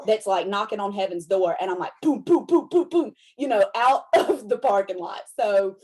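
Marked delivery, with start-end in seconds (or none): 2.2-3.6 mock backfire. Imitating car backfiring.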